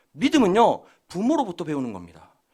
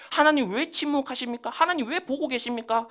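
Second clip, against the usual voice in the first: higher-pitched, plaintive questioning of God; acted complaint; 6 semitones higher than usual. Preacher voicing David's psalm to God, acted complaint. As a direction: protest as the psalmist would. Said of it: "막 불평, 불만을 하고요".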